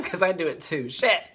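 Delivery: ordinary talk, nothing marked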